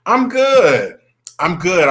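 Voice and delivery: high, loud, sweetly fake "I'm good"; a hint of a sassy mom brushing you off; Mama Joyce treatment. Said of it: "giving you the Mama Joyce treatment".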